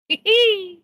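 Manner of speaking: high, loud gleeful cackle; mad scientist cackle. Acted. Cackle after explosions.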